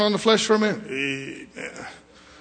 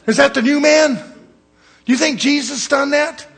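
preaching loud; 9 semitones higher, 8 dB louder than usual. Real, not acted. Preacher's heated rhetorical challenge.

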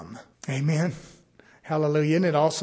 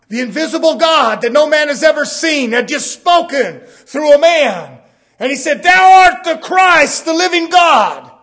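full-force preaching; 10 semitones higher, 15 dB louder than usual. Real, not acted. Preacher proclaiming God spoke through a man, shouted preaching. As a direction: proclaim it at the top of your voice.

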